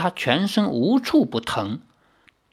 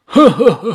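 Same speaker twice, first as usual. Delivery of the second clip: ho, ho, ho from a hidden foe, louder and higher; eerie laugh; 5 semitones higher, 7 dB louder than usual. Acted. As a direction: unseen enemy taunting, with a ghostly laugh. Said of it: "诚挚虚渺的笑声".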